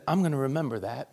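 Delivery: ordinary delivery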